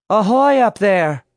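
loud, high-pitched hail; calling up. A narrator's reading. Mary hails Alice up on the ceiling, calling out. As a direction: call out to someone high overhead. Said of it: "Mary called".

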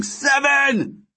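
very high anguished cry; high-pitched desperate countdown shout; tearful scream. A narrator's reading. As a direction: scream the number, choked with tears. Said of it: "the sound of Chen screaming".

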